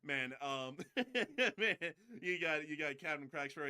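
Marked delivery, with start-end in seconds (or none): 0.8-1.5 short high burst of laughter. Real, not acted. Laughs at Crack Sparrow joke.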